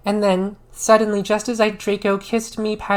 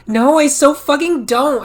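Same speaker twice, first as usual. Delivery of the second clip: loud, higher shout; 6 semitones higher, 5 dB louder than usual. A narrator's reading. Ebony shouting at her friend about Draco, loud. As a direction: yell it back at her. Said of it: "I shouted".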